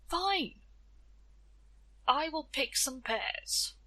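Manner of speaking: slow, fed-up giving in; exasperated. Acted. Death giving in to Tia, slow and exasperated. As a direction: sigh it out as if beaten. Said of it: "Death was so exasperated".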